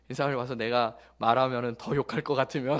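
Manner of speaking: usual speaking voice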